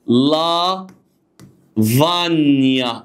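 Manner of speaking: "فانيا" slow, as "va-nya" after separate "لا". Shown as a demonstration. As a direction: draw out "فانيا" with a silent g. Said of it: "تتنطق ني".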